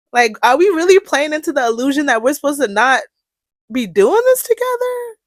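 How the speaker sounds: high, loud incredulous question; exasperated disbelief. Real, not acted. Host challenging the community to work together, exasperated disbelief. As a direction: push the question up to a high finish.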